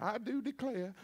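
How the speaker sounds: mock Southern genteel exclamation, quiet; Southern belle. Pastor joking about Southern meaning, mock Southern belle. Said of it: "you grow up in the South".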